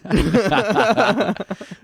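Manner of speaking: high burst of laughter; loud laugh. Real, not acted. Laugh after Vietnam joke.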